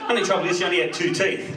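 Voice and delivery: ordinary talk, nothing marked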